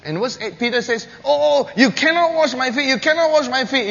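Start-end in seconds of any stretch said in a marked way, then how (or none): 1.2-3.9 high, loud refusal; Peter's loud protest. Acted. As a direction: Peter pleading and refusing at once. Peter protesting, voiced loudly.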